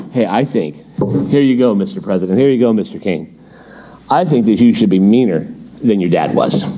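low-pitched mock counsel to a king; young royal adviser. Palace brats advising the new king, low-pitched mock counsel.

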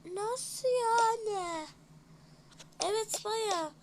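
slow high astonished question; drawn out in disbelief; amazed. Acted.

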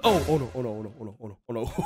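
quiet giggling panic; soft laughing oh no under breath; chuckling, mock panic. Real, not acted. Caught on the lead, panicking.

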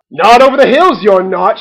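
loud motherly prohibition; shouted. A narrator's reading. Mother forbidding the ducklings to go, shouting. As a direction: shout it as a firm no. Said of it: "shouted their mother".

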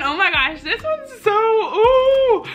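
very high squeal with a laugh; giddy anticipation. Real, not acted. Vlogger teases her next lesson to viewers, giddy. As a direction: squeal into it, letting the ooh soar.

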